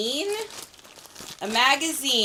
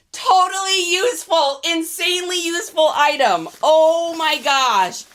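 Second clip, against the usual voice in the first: delighted; 5 semitones higher, 4 dB louder than usual. Real, not acted. Gushing over a rock gift.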